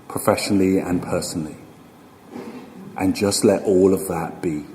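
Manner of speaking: slow, unhurried meditation guide with long pauses; guided meditation. Acted. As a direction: ease them into stillness, phrase by phrase, leaving room to breathe.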